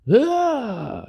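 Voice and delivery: high-pitched power-up cry; a sudden high shout as they merge; fusion yell. Acted. Fusion happening.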